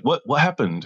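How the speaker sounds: a coworker jolted by news of a snake bite, mildly alarmed. Retold or imitated. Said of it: "he's like".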